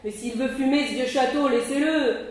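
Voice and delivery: loud, mocking jeer at the cowards; mock them loudly from the doorway; ironic scoffing. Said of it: "D'un ton passablement ironique". (raillerie ironique et forte)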